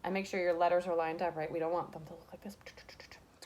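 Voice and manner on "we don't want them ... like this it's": whisper. Real, not acted. Teacher whispering aside.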